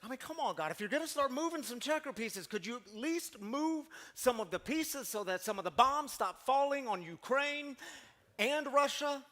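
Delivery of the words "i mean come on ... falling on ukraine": usual speaking voice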